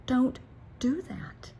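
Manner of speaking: "Don't" high, then "do that" whispered; sharp Don't, then a hushed do that; hushed warning. Shown as a demonstration. Coach warning parents off derogatory talk, dropping to a whisper. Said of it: "don't be negative and derogatory".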